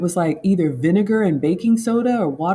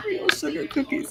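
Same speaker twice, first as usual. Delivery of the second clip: "real sugar cookies" in a much higher, softer squeak; squeaky confession; 11 semitones higher, 6 dB quieter than usual. Acted. Host admits real sugar to class, high guilty squeak. Confessing non-diet baking.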